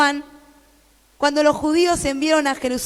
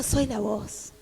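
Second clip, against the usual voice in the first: suave; 9 semitones lower, 10 dB quieter than usual. Shown as a demonstration. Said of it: "repite la frase, contraste".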